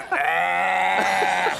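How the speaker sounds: high strained goat cry; feeble goat bleat. Retold or imitated. Imitating an old goat's weak bleat.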